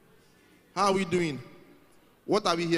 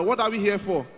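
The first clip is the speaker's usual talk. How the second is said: ordinary delivery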